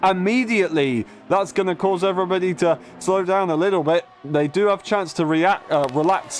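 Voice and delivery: lifted live race call; motorsport commentator. Real, not acted. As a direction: keep the excited play-by-play going. Live race play-by-play continues.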